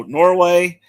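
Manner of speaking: normal talking voice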